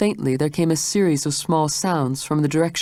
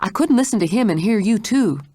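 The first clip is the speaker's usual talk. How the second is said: anxious; 7 semitones higher than usual. A narrator's reading. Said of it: "she said anxiously".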